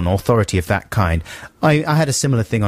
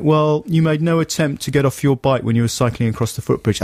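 mild officious lecture; a hint of a smug policeman; lightly voiced cop; 5 semitones higher than usual. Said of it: "He's like".

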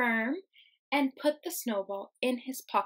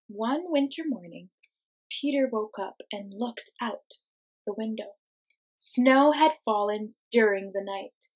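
put-on lively, expressive read-aloud for kids; reading to children; 5 dB louder than usual. Teacher reading a picture book to her class, lively storytime voice.

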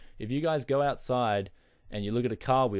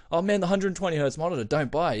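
louder, higher, fast nagging; mock insistent nagging; 6 semitones higher, 5 dB louder than usual. Jealous friend nagging again about the monitor, loud and fast. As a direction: keep pushing it on him, louder and quicker. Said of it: "he's just like".

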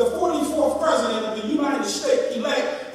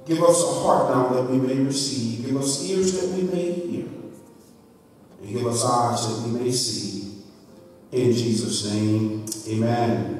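prayer; 10 semitones lower than usual. Acted.

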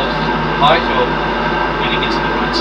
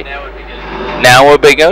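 pointed urging; 7 dB louder, much slower than usual. Real, not acted. Co-pilot urging a late turn.

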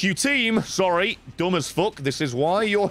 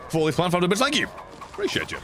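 ordinary talk, nothing marked

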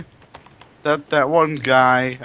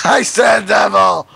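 shouted repeat, higher and louder; shouting; 9 semitones higher, 6 dB louder than usual. Real, not acted. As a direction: call it out again much louder. Repeating guess louder, unheard.

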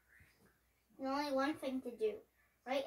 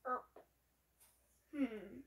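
plain reading voice

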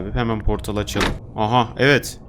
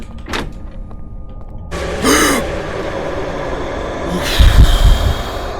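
sharp gasp; 10 semitones higher than usual. Real, not acted. Gamer startled by something on screen, sharp gasp. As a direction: suck in air in sudden fright. Sudden scare in horror game.

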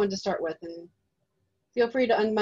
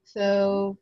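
normal talking voice

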